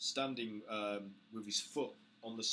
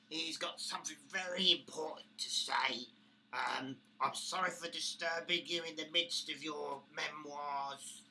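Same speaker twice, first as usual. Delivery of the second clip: high, timid goblin voice begging the boss; nervous goblin; 6 semitones higher, 6 dB louder than usual. Acted. Goblin interrupting his boss, nervously apologetic high voice. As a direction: cringe and grovel as a scared goblin.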